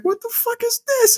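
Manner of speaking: very high-pitched mock disbelief; incredulous squeal. Friend retelling the base camp twist, incredulous squeal. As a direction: squeak it in comic bewilderment. Repeated baffled reaction to film.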